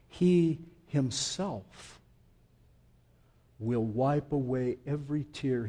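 slow, weighted stress on He and Himself; emphatic He Himself. Shown as a demonstration. Preacher stressing He Himself to the congregation. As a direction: lean hard on "He Himself", slowly. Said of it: "It's an emphatic".

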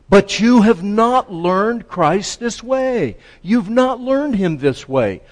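high, loud, emphatic declaration; emphatic preacher quoting scripture. Acted. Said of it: "he says emphatic".

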